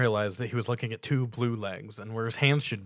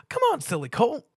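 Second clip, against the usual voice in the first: very high, loud bubbly pony voice; Pinkie Pie; 5 dB louder than usual. A narrator's reading. Pinkie Pie greeting Stuart with a loud high squeal. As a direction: squeal the welcome like an overexcited pony. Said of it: "Pinkie called back".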